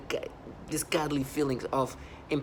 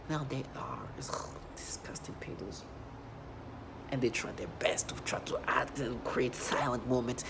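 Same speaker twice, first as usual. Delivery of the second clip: whispered aside; 6 dB quieter than usual. Real, not acted. Speaking to viewers about the perps, disgusted whisper. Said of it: "whispered aside".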